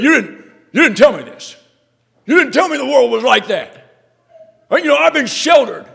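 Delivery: put-on loud, high outburst of an angry girl; angry daughter. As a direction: yell it at daddy, hurt and furious. Angry daughter confronting her father.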